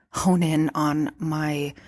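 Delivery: ordinary delivery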